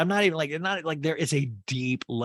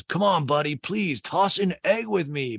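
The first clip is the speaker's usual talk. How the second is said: imitated pleading; 7 semitones higher, 4 dB louder than usual. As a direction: beg a buddy in a raised, wheedling voice. Acting out Craig begging his buddy.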